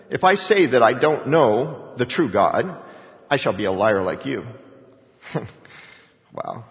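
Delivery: usual speaking voice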